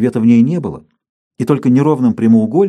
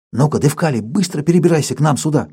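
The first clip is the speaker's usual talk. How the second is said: ordinary delivery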